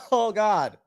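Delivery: recovering from a cough; mid-coughing fit. Real, not acted. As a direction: groan it out after real coughs. Coughing fit after smoking.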